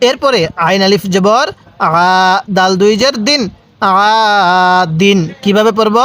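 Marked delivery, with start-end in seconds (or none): 3.8-5.2 "আ" held out, then short "দিন"; one-alif madd. Shown as a demonstration. Teacher shows the empty-alif rule, stretched "আ" before "দিন". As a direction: draw out the "আ" before saying "দিন". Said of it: "এক আলিফ টান দিব".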